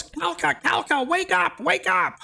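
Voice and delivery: very high squeaky call to wake the guardian; squeaky crayfish calling. Acted. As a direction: squeak urgently to rouse the giant crab. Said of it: "he calls out".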